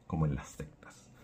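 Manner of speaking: a quick hushed whisper of an aside. Real, not acted. Teacher whispering an aside about sects to the class. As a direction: drop to a whisper for the side comment.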